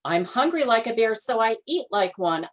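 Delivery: hungry bear explaining. A narrator's reading. Bear speaks.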